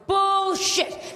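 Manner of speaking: sudden indignant outburst; outraged cry. Real, not acted. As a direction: blurt it out in disgust. Outburst at office menorah.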